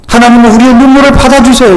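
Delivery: normal talking voice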